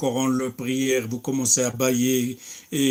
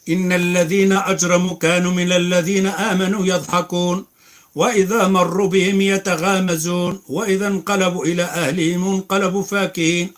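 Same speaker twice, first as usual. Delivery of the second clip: fast, raised chanted recitation of the verses; louder melodic tajwid recitation; Quran recitation; 4 semitones higher, 5 dB louder, much faster than usual. Acted. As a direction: recite like the imam, quick and melodic.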